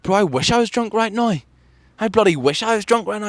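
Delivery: high-pitched exasperated comeback; retold retort. Answering a man who called him drunk, exasperated retort. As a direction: push the voice way up in mock protest.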